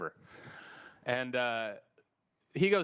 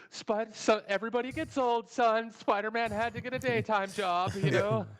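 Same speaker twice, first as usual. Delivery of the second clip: much higher, put-on parent voice; mock parent explaining; 10 semitones higher than usual. Parent explaining the fat Spider-Man to his son, squeaky put-on voice. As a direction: explain it to the child in a silly high voice. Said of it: "they're like".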